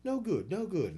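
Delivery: quick scoff like the coach; mock coach scolding. Athlete mimics coach rejecting a throw. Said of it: "or he'd say".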